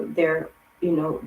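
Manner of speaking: ordinary talk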